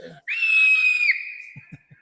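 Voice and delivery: long high play-acted scream; high-pitched staged shriek; scream on cue.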